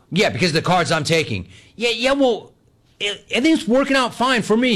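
high, exasperated protest; defensive retort. Retold or imitated. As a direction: argue your case, pitch climbing. Retold quarrel, defending himself.

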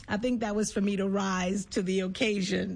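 ordinary talk, nothing marked

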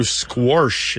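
"squash" said as squorsh; intrusive R. Shown as a demonstration. Host illustrating garden talk in dialect, "squash" with an added R. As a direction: put an R before the sh in "squash". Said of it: "sometimes you'll hear".

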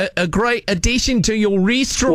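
broad put-on Aussie accent; Australian accent. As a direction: greet them in a hammed-up Australian accent. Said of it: "with a slight Australian accent".

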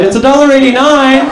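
loud, high-pitched holler; mock helpful employee calling to a customer. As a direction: shout the price as if through a drive-thru. Calling out the price from inside.

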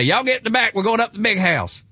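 hammed-up country Southern voice; Southern drawl. Foxworthy's God calls to the new arrivals in country Southern. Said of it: "pull up in the pickup truck and say".

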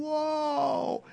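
high, drawn-out whoa of wonder; amazed whoa. Retold or imitated. Awed at canyon, high.

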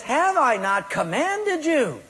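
God confronting an excuse-making believer, slow and high; God commanding. Retold or imitated. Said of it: "and he says".

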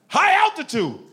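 "High altitude" said high and loud, falling. Shown as a demonstration. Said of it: "That's right".

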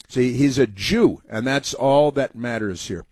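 ordinary delivery

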